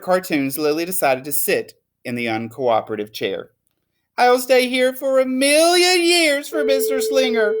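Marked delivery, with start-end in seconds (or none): 4.2-7.5 put-on higher, louder pouting child voice; sulky little girl. Lily sulks in the uncooperative chair, pouty high child voice. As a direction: sulk like a wronged little girl.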